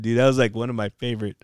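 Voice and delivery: usual speaking voice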